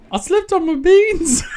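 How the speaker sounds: put-on high, laughing Aussie kid voice; second try at Australian accent. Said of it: "I can't do it".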